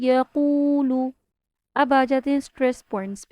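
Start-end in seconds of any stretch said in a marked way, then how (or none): 0.0-0.9 قو long, ل short in یقول; recited demo. Shown as a demonstration. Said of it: "لام کی ہوگی ون کاؤنٹس". (یقول صحیح تلاوت)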